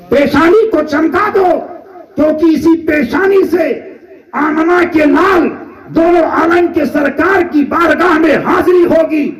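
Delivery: fervent sermon cry; preaching at full force. Acted. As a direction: proclaim it to the whole hall. Sermon climax.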